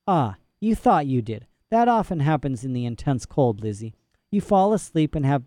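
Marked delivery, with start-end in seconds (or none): none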